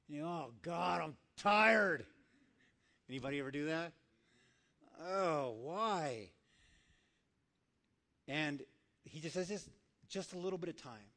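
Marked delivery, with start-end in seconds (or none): 0.3-2.0 hammed-up tired whine, building to loud; whiny complaint. Acted whining reply to early waking. 5.0-6.3 acted whine. Acted complaint at being woken. 9.5-11.1 soft, gentle plea; still small voice. Acted. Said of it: "The still small voice".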